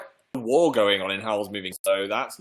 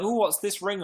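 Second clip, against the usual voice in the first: mock curious; 10 semitones higher than usual. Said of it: "I'd be like".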